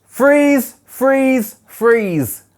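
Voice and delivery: loud, high repeated calls, easing down; calling out. Real, not acted. Teacher halting the angry readers with loud calls. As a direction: call out to stop everyone.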